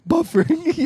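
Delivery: high gleeful shout; excited punchline. Real, not acted. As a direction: crow the punchline.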